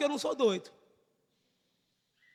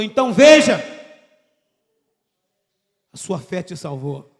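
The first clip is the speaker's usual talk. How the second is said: voice of Jesus; 7 semitones lower, 10 dB louder than usual. Retold or imitated. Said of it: "E Jesus falou".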